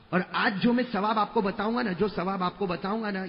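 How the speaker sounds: usual speaking voice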